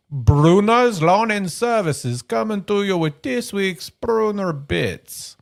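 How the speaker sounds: put-on slow, drawn-out Transylvanian accent; hammy Transylvanian vampire accent. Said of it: "trying to throw on my Transylvanian accent".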